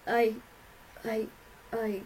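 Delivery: halting stammer with gaps between tries; cowering. A narrator's reading. Father cowering before Rainbow, stammering. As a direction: falter on the word, cowed. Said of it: "her father cowered".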